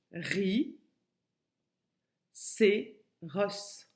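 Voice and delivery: "ri, c'est ros" with a long silent beat after "ri"; slow, separated syllables: "ri, c'est ros"; syllable by syllable with silent gap. Shown as a demonstration. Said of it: "la syllabe que tu enlèves dans ta tête".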